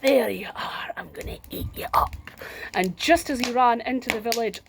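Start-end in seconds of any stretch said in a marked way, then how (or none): 0.0-2.1 giant's menacing whisper. Acted. Giant waking to find the girl on his belly, menacing whisper. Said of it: "he went".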